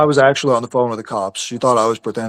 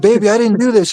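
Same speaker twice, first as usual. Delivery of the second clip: higher, mock pleading; retold plea, pitched up; 8 semitones higher than usual. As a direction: plead with her, raised and earnest. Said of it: "I'm like".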